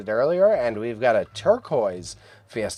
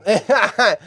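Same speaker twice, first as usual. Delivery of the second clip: loud high laugh; 9 semitones higher, 7 dB louder than usual. Real, not acted. Laughing at lamp idea.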